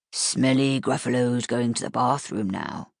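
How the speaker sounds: low, gentler monster growl; a milder Gruffalo leaving for the bath; softened Gruffalo voice. Acted. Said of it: "softened the tone a little".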